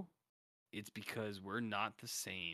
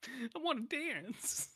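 high sing-song voice; 14 semitones higher, slower than usual. Pretend. High slow put-on voice.

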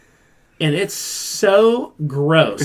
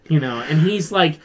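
usual speaking voice

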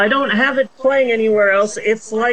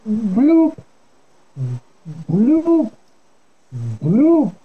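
high playful bubble sound "bloop"; underwater bloop; 3 semitones higher, much slower than usual. Pretend. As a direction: mimic a bubble rising through water. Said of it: "I sound like I'm underwater?".

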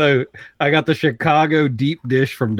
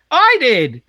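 lightly voiced little kid; 9 semitones higher, 4 dB louder than usual. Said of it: "He goes".

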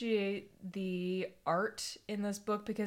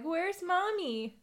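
high, drawn-out little owlet voice; a lost chick asking, pitched up; slow, plaintive baby-animal voice; baby owls; 9 semitones higher, much slower than usual. Acted. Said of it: "And they're like".

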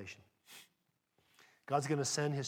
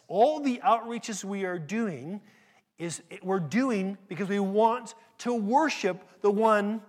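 loud, slow, higher climax of the sermon; preaching at full force; 7 semitones higher, 8 dB louder, slower than usual. Real, not acted. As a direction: thunder it out to the church.

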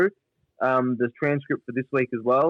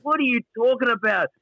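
high exasperated Gary; 9 semitones higher than usual. Retold or imitated. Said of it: "Gary's like".

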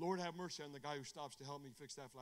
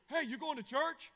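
high, loud challenge to a stranger; calling out; 10 semitones higher, 8 dB louder than usual. Acted. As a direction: hail them boldly with a rising question. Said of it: "I looked at them, I go".

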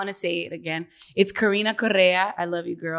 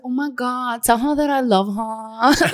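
[name]; 4 semitones higher, 3 dB louder than usual. Retold or imitated. Imitating drunk friend declaring love for Sarah, gushing. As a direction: gush like a tipsy friend, stretching it out. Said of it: "every time I would imitate her".

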